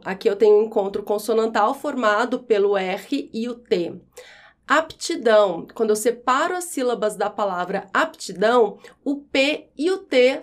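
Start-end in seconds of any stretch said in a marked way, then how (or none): none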